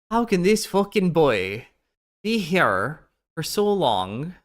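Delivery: a bad Arabic-Irish accent that slides Scottish; failed Arabic-Irish accent. Mocking Anissa with an accent that comes out Scottish. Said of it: "I'm so terrible at this. I'm doing, like, almost like a Scotch".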